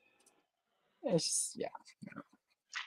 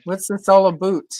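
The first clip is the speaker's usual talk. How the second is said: louder mock Canadian accent, "a boot" for about; 5 semitones higher, 7 dB louder than usual. Joking about Canadians, a louder mock Canadian "a boot". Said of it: "It's like".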